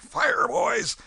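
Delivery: loud shouted order to fire; sudden loud battle cry; shouted command. Retold or imitated. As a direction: bark the order at the boys. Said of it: "I sung out".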